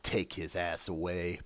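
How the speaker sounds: low, quiet, slow curt order; stern captain. Pretend. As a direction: dismiss him coldly and slowly. Janeway dismissing Tuvix, joking.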